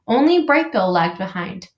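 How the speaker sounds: usual speaking voice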